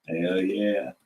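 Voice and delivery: low, drawn-out hell yeah; deep hell yeah. Acted. Voiceover, deep catchphrase.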